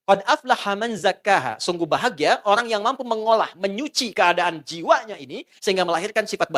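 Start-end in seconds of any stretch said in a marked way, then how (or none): 0.1-1.6 slow recited verse. Shown as a demonstration. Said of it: "Perhatikan kalimatnya".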